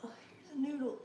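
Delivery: breathy whisper, barely voiced. Real, not acted. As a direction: whisper it under your breath. Fishing for noodle while tasting.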